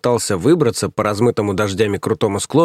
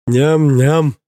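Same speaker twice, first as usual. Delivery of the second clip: put-on louder baby-talk yum; childish babble; 5 semitones higher, 4 dB louder than usual. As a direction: drool out a toddler's yum. Idiot stares at bust.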